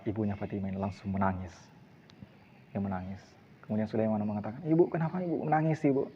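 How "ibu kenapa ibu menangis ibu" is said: high, startled plea to a weeping mother; alarmed question. Acted. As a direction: ask in sudden alarm. Young man alarmed by crying mother.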